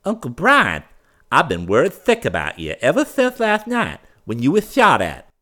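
butter up the uncle; fawning. A narrator's reading. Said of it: "in a more fawning tone".

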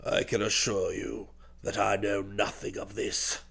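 low, hushed aristocratic composure; frightfully calm. A narrator's reading. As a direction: stay icily calm, very soft and low. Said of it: "He was frightfully calm".

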